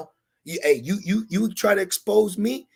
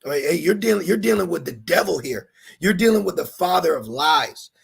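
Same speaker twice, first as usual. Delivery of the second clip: usual speaking voice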